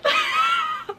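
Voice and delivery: very high, loud laugh; giddy squeal. Real, not acted. Vlogger overwhelmed by the advance copy, high giddy laugh. Giddy over receiving the book.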